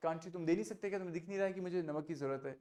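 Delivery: soft, pointed "Can't you pass me the salt?" with a sting; taunting. Shown as a demonstration. Teacher acts out taunting someone ignoring a request for salt. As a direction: needle the person who won't pass the salt. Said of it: "थोड़ा सा ताना मार रहे हो उस व्यक्ति को".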